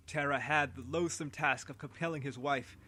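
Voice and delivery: usual speaking voice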